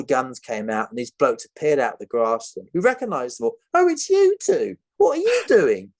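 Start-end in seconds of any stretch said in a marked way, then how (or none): 3.7-5.9 high, loud mock surprise at old hostages; surprised captor. Captor spotting his escaped hostages, high and surprised. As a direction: squeal it like a startled jungle guard.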